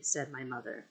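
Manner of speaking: plain reading voice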